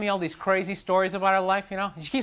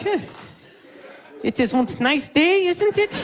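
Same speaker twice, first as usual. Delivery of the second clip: nervous small talk; 7 semitones higher, 6 dB louder, slower than usual. Retold or imitated. Small talk with angry Hitler.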